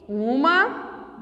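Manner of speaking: ordinary talk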